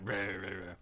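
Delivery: soft, low mock grumble; lightly voiced grumbly dog noises; imitating dog grumbling. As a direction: grumble quietly like a disgruntled dog. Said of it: "She's grumbling. She's like".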